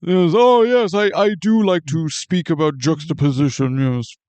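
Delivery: snooty critic voice; languid pretentious art snob. Pretend. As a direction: purr it like a pompous critic. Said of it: "Mister Snooty, uh, critic mode".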